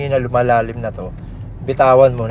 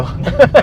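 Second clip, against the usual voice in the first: loud laugh; 14 semitones higher, 8 dB louder than usual. Real, not acted. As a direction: burst of high loud laughter. Laughs at own joke.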